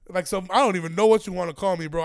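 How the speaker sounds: ordinary talk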